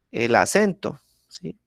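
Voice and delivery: normal talking voice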